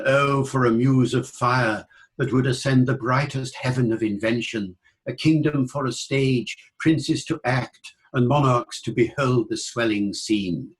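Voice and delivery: verse in standard Received Pronunciation, silent R after vowels. Shown as a demonstration. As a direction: recite it in modern British RP. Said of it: "British English would go in received pronunciation".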